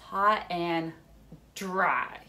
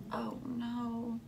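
soft dismayed whisper; hushed dismay; 3 semitones higher, 5 dB quieter than usual. Pretend. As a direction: breathe it out, worried. Seed drowns.

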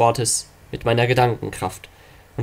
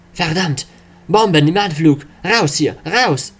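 higher, louder alarm yell; panicked shout; 4 semitones higher, 3 dB louder, slower than usual. Acted. Soldiers flee falling bombs.

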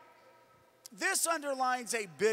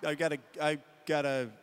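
low, mildly evasive boss; dismissive boss; 9 semitones lower, 4 dB quieter than usual. Acted. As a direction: brush off the employee in a lower voice. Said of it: "I'll be Phil Griffin".

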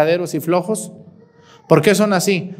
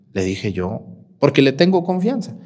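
normal talking voice

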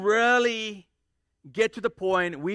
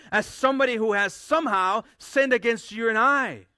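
loud, raised, driving sermon voice; full-force preaching; 5 semitones higher, 6 dB louder than usual. Acted. Preacher rebuking a wrong idea to the church, loud and driving. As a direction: hammer the point home to the congregation.

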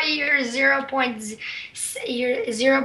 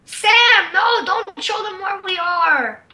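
alarmed protest; 6 semitones higher, 4 dB louder than usual. Real, not acted. Protesting friend showing base.

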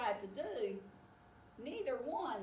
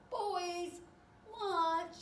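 high, raised parent's call; 9 semitones higher, 4 dB louder than usual. Acted. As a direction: call the children in to lunch. Parent calling boys to lunch.

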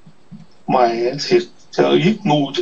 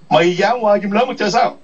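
higher-pitched, provoking question; taunting challenge; 6 semitones higher than usual. Real, not acted. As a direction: throw it out as a dare. Taunting a rival with repeated challenge.

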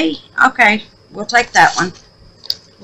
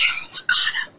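whisper; 9 dB quieter than usual. Real, not acted. Forgot to announce something.

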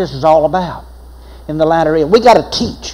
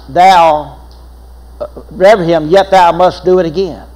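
ordinary delivery

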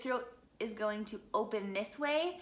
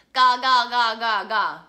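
ga shouted much louder, pushed and forced; loud wrong ga; 14 dB louder than usual. Shown as a demonstration. Coach showing students the wrong, loud ga. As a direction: bark out ga too loudly on purpose. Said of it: "I'm not doing this really loudly. I'm not going".

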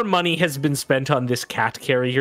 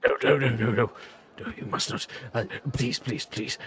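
soft, breathless begging; half-whispered desperate please; frantic pleading; 6 dB quieter, faster than usual. Acted. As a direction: beg them to stop, hushed. Servant begging them not to.

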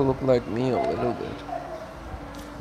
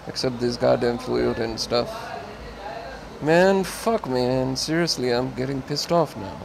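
usual speaking voice

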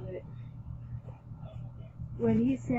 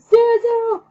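high, loud scream of a name; 12 semitones higher, 16 dB louder than usual. A narrator's reading. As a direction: scream out for someone, pitched way up. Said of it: "I screamed".

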